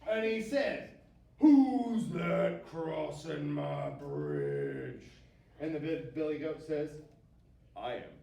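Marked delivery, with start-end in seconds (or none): 1.4-5.0 loud, slow troll challenge; big troll voice, drawn-out words; cranky troll. A narrator's reading. 7.8-8.1 short, lower-pitched goat answer; unbothered big goat, lightly voiced; big billy goat. A narrator's reading.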